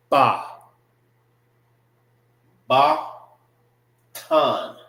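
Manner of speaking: ba and Tan each alone, firm 'bah', then 'tahn'; syllables pronounced separately. Shown as a demonstration. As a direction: sound out ba, Ba, Tan with long gaps between. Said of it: "This is pronounced by itself".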